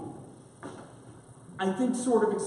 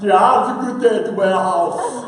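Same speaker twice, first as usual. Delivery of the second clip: louder cartoon strongman voice, laughing; laughing, hammed-up muscleman voice; exaggerated cartoon intonation; 8 dB louder than usual. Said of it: "Why are you talking like that?".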